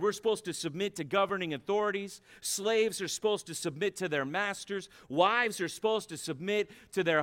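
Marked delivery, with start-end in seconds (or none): none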